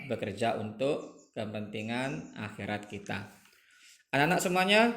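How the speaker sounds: ordinary talk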